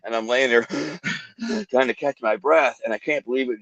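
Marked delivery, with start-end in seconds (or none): 0.7-1.6 panting gasp. Retold or imitated. Catching breath after running.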